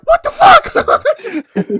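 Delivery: very high, very loud, slow shriek through laughter; hammed-up outraged screech; shocked shout. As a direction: scream it like a stabbed villain. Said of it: "And Storm's like".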